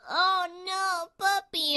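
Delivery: high-pitched, fussing pet-lover voice; distressed cooing. Retold or imitated. Dog lover reacting to a dog upset, distressed cooing.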